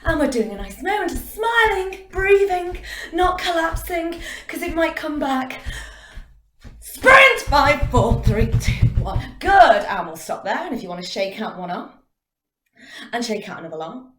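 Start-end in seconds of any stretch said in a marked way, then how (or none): 0.1-5.6 bright encouragement. Acted. Coach encouraging during happy jog. 6.8-7.4 sudden loud shout of sprint; shouted command. Shown as a demonstration. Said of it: "I will shout sprint". 7.4-9.3 loud countdown. Acted. Coach counting down sprint.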